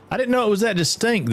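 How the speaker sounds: high, fast, amused disbelief; surprised. Real, not acted. Streamer reacting to a viewer's accent remark, surprised and amused. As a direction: caught off guard by the compliment.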